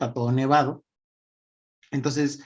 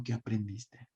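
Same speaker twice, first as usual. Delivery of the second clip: gentle question; 5 semitones lower, 12 dB quieter than usual. Retold or imitated. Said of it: "le digo".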